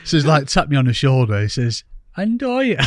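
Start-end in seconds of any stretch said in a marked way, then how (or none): 2.2-2.8 high, exaggerated Brummie twang; Brummie accent. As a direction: stretch the name in a hammy Brummie accent. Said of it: "attempt to do a Brummie accent".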